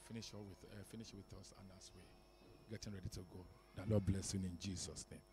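ordinary talk, nothing marked

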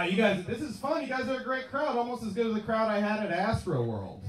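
ordinary talk

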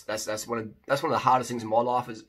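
ordinary talk

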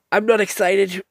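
loud, high and exasperated; high, loud I'm not excited; exasperated outburst. Real, not acted. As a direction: belt it out in disgust. Declares boredom with the movie.

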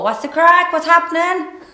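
loud, high-pitched exaggerated Cork accent. Shown as a demonstration. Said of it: "So you need to go".

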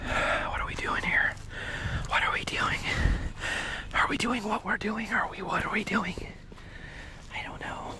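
hushed, very soft whisper. Real, not acted. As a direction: whisper as if someone might overhear. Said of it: "Why am I whispering?".

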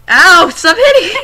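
shouting through laughter; laughing yell. Real, not acted. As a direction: yell it while cracking up. Friend hitting him while recording.

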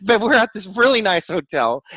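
high, loud, laughing delivery. Real, not acted. Telling of a drunk guy at a nice hotel, laughing. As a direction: crack up while telling it.

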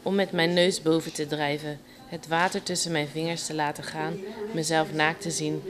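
soft, understated poem recital; quiet spoken poetry in measured phrases; poem reading. Acted. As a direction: read your poem softly, pausing between lines. Recites her own poem.

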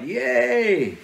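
high, loud mock cheer; retold celebration. Hunter seeing his deer go down, retold cheer. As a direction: let out a hammed-up little victory cry.

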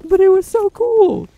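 very high loud squeal; delighted squeal. Real, not acted. Gamer thrilled by a cool kill, high squeal. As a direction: squeal with glee.

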